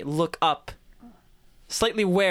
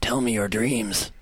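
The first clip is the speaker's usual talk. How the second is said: slow, low creepy ghoul voice; grim reaper voice; 7 semitones lower, slower than usual. Acted. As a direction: loom over them like death. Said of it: "I'm gonna keep murder my voice".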